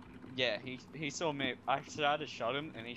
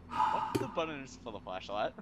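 usual speaking voice

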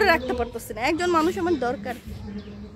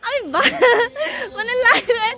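mock whine; 8 semitones higher, 6 dB louder than usual. Mock horror at washing dishes.

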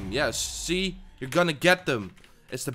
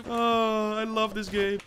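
usual speaking voice

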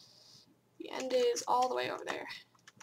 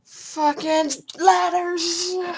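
slow, drawn-out, loud angry yell; furious yell; 5 semitones higher, 11 dB louder, much slower than usual. Real, not acted. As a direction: stretch out every word in rage. Raging at ladders in game.

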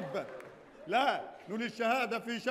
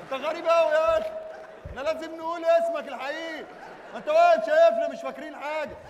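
loud, raised-voice exasperated complaint; worked-up, irritated; 3 semitones higher, 5 dB louder than usual. Acted. Prince complains that his partner just stands watching, loudly irritated. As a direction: have a loud go at him, voice pushed up. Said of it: "الأمير متعصب بعد شيئا ما".

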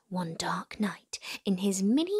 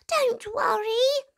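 high, slow, gentle squeak; a small mouse calming a lion; reassuring mouse peep; 14 semitones higher, 3 dB louder than usual. A narrator's reading. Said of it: "Mouse peeped".

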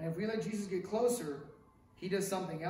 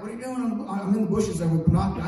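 mock snooping pastor; 11 dB louder, faster than usual.

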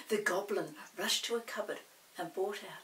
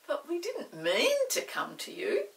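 truthful Molly; 10 semitones higher, 6 dB louder than usual. Acted. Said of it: "said Molly truthfully".

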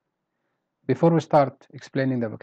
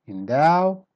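"Endow" with louder "dow"; weak then strong; 3 semitones higher, 5 dB louder than usual. Shown as a demonstration. Teacher repeating the word, "Endow" stressed on the second syllable. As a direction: let "Endow" grow strong on the end. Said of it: "then become strong".

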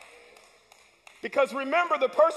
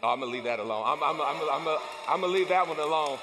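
much lower, softer groggy regret; morning-after regret; 10 semitones lower, 4 dB quieter, faster than usual. Retold or imitated. Churchgoer regretting last night, groggy and low. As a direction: sound like you just woke up sorry. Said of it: "you said".

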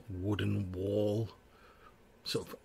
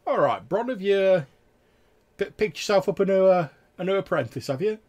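high, loud teasing guard voice; a higher-pitched guard ribbing an old friend; louder and higher, playful needling; 10 semitones higher, 10 dB louder than usual. Acted. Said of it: "the guy's like".

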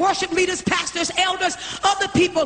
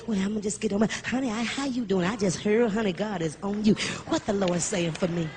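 much softer mock gossip, hushed honey talk; gossiping church member; 8 semitones lower, 6 dB quieter than usual. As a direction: pass on the rumour in a hushed put-on voice. Mocking word-seeking churchgoer.

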